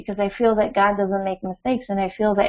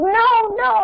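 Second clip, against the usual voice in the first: put-on child's tantrum; 11 semitones higher than usual. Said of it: "she goes".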